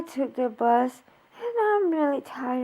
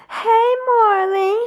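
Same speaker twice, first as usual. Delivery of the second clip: slow, louder crying hello; tearful greeting; 6 semitones higher, 9 dB louder, slower than usual. Real, not acted. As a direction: greet the dog through tears, slowly. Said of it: "I'm gonna try not to cry anymore".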